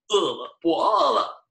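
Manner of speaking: tortoise asking for food; high hungry tortoise. Acted. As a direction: play the tortoise begging for food.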